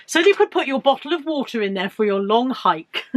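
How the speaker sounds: usual speaking voice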